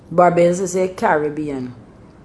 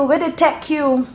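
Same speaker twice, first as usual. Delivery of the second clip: Bajan "Where they take you?", take as tech, higher; Bajan phrasing; 9 semitones higher than usual. Shown as a demonstration. Explaining Bajan shortened words, Bajan accent. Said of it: "take is basically to say".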